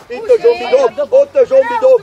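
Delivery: loud, fast sideline shout; urgent shouted instruction. Real, not acted. Coach directing Zsombi's throw-in, urgent shout. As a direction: shout it across the pitch in a rush.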